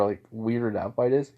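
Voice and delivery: plain reading voice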